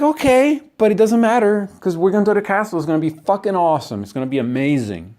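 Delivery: high, fast, gushing excitement; eager excitement. Retold or imitated. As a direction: gush like a kid about the castle. Excited quote of eager visitor.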